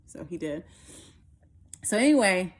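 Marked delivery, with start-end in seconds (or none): none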